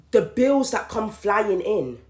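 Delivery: ordinary talk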